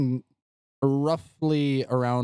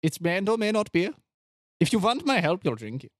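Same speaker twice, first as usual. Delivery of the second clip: higher, put-on doctor voice; coaxing doctor; 5 semitones higher, faster than usual. Doctor presses visitor to drink potion, coaxing. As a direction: press the offer as a scheming doctor. Plays Doctor Valentine in scene.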